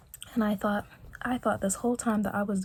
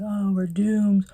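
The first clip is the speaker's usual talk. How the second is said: louder, slow mock despair; mock doom; 7 dB louder, slower than usual. Her inner voice, telling herself they are doomed, in mock despair. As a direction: wail it like all is lost. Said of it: "in my head where I'm like".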